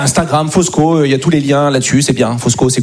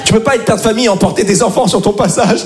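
ordinary talk, nothing marked

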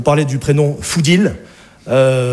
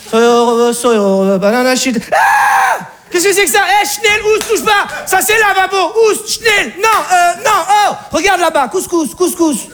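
much higher shrieked alarm, chasing him off; panicked mother; 13 semitones higher, slower than usual. Acted. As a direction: shoo him away in terror.

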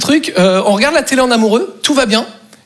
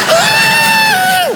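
very high, long mock scream; outraged shriek. Girlfriend hearing the truth, high-pitched mock shriek. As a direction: shriek at the top of the range.

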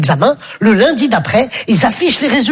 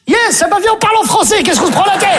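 mock schoolkid; 7 semitones higher than usual. Pupil mocking his classmate in English class. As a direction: play the cheeky pupil.

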